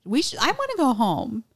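hammed-up childish plea; mock whine. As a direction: whine it like a kid wanting home. Retelling girl's wish to go home.

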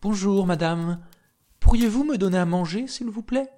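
high, quick, sweetly polite request; a small boy begging nicely. Acted. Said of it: "il la salua bien poliment". (petit garçon poli, voix aiguë et pressée)

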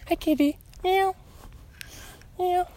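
very high little meows to a kitty; high sing-song cat talk; cooing at a cat. Real, not acted. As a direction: call the cat in a tiny high voice. Greeting a cat on walk.

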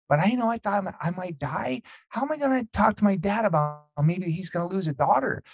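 lightly voiced daughter, held high throughout; imitated daughter. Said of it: "I look at the daughter".

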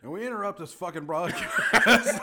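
loud, high mock bulletin; fifties broadcast announcer. Fifties announcer breaking into a show, loud mock alarm. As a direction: blare out a parody emergency interruption, laughing. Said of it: "nineteen fifties, like, cir-, closed circuit T V".

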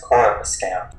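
Coruscant as "KOR-uh-skant", with a hard sk; variant pronunciation. Shown as a demonstration. Co-host listing ways they've heard it, Coruscant as "kor-uh-skant". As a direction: say Coruscant with "or" first and "skant" last. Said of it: "pronounced so many different ways".

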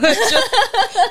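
bright high laugh. Real, not acted. Host laughs. (高い笑い声)

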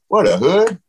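high, baffled question; mock the kid who's never seen a front trunk; confused surprise. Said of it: "I'm like".

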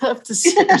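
laughing through the words. Real, not acted. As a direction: giggle out the end of it. Laughing at own breathlessness.

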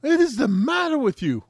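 retold scolding of the friend; exasperated shout. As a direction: bark it in disbelief. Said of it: "And I'm just like".

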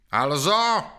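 one loud, high roared word. A narrator's reading. Sergeant Krpata roars at the prisoners before shooting. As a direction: roar it like a drill sergeant. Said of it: "zařval Krpata".

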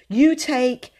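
keen, urgent planning; excited. A narrator's reading. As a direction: start handing out orders excitedly. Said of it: "he said excitedly".

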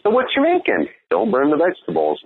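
put-on very high, bouncy childish voice; goofy kid voice after a kiss; playful kid voice. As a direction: chirp it like a silly seven-year-old. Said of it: "almost like a seven-year-old playful".

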